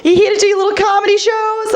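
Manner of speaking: high gushing welcome; sing-song happy greeting; imitating the excited family at home; mock excited family. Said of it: "my family gets all excited".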